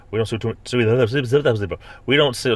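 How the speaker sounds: slow, tongue-tied stumble over the words; lips sticking together. Real, not acted. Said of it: "my lips are sticking together".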